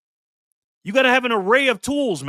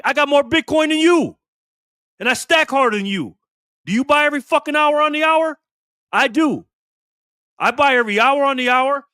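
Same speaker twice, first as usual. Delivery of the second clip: louder and higher, pushing each boast; heated bragging; 5 semitones higher, 4 dB louder than usual. Real, not acted. Ranting at inbox critics, loud and boastful. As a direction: hammer the brag home at the haters.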